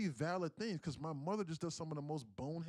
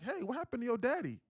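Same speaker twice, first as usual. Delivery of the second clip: high mocking imitation of his mother, louder; imitating mom, much higher pitch; mom's voice; 10 semitones higher, 7 dB louder than usual. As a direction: squeak it out like his mom nagging. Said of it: "and she's like".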